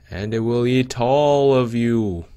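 loud, slow menacing threat; villain boast. Pretend. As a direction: announce world domination. Doge takes over.

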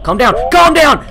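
high, loud; yelling calm down. Real, not acted. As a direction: shout at the screen. Yelling at the game.

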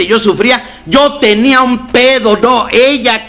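más agudo y firme, predicando a los compañeros; predicación de reunión. Acted. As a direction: predica con convicción. Predica el mensaje de AA. (el orador predica a los compañeros, más agudo)

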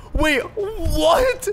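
high-pitched disbelieving question; can't believe what just happened; incredulous. Real, not acted. Stunned by the scan result.

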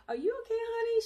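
high, sweet concerned voice; concerned nurse. Retold or imitated. Nurse checking on her calm patient, high and sweet. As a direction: sound like a kindly nurse checking in. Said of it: "the lady's like".

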